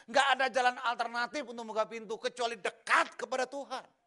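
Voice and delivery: loud, high, proclaiming blessing; preaching at full force. Acted. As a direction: declare it over the congregation. Blessing declared from pulpit.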